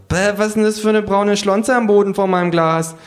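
high kangaroo voice, disgusted. Acted. Kangaroo finding brown gunk by its glass, high disgusted. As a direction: recoil at the muck.

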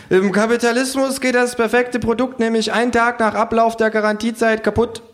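hohe, dozierende Figurenstimme; Känguru. Acted. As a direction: mit hoher Stimme belehren. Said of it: "ruft das Känguru". (hoher Känguru-Vortrag)

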